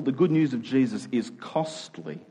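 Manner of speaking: usual speaking voice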